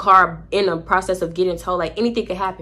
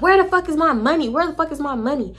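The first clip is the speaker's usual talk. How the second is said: retold yelling; 8 semitones higher than usual. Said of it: "she starts screaming".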